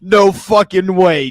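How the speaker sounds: mock disbelief. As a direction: play an astonished dad, hammed up. Voices the astonished dad.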